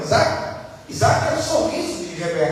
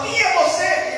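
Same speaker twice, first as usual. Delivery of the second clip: questioning father; 9 semitones higher than usual. Retold or imitated. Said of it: "o pai fala".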